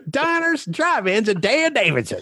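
high, sing-out show title; mock show announcer. Riffing on the triple D show title, mock announcer.

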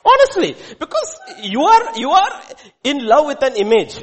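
high, loud, fervent preaching. Real, not acted. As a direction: proclaim it with rising passion. Preacher excited about marriage illusion.

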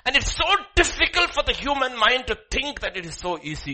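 high, loud exclamation; emphatic preaching. Real, not acted.

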